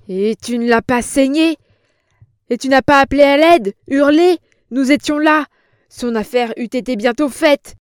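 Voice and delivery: furious boss tearing into her; angry shout. A narrator's reading. Boss berates old woman.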